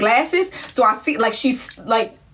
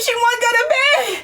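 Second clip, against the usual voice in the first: usual speaking voice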